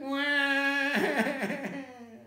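long, high fake cry; hammed-up "waah"; child's wail. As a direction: bawl like a small child. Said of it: "There she is crying".

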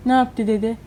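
ordinary delivery

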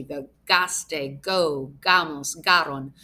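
"gaste, go, gamos, garon" with a hard G like in go; hard G endings. Shown as a demonstration. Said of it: "These have the hard G".

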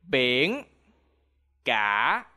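Biển cả with cả dipping low; Vietnamese tones. Shown as a demonstration. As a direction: drop cả low, then let it rise. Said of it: "Repeat after me".

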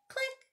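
high, quick clicking sound; little high-pitched camera click. A narrator's reading. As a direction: chirp a tiny shutter click.

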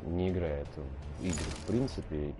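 ordinary talk, nothing marked

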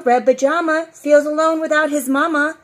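plain reading voice

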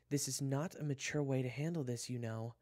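high, loud exasperated reproach; exasperated scolding. Acted. June chides Kyler over his tantrum, exasperated. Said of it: "June sighed".

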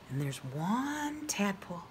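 soft whispered aside; whispering. Real, not acted. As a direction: hush it like a secret.

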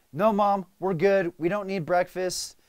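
warrior mode. Retold or imitated. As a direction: bulldoze everyone to get moving. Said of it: "So I'm like".

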